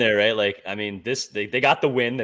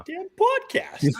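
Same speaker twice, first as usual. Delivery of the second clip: mock outrage; slower than usual. Mock outburst about podcast.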